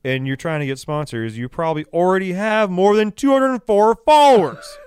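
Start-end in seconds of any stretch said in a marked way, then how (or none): 1.4-4.8 raised voice, pitched higher, hammering the point; exasperated. Real, not acted. Telling sponsor seekers about follower counts, exasperated. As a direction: climb louder and higher in exasperation.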